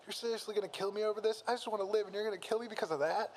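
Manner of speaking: high-pitched disbelieving character voice; indignant protest. A narrator's reading. Character protests being killed in fight.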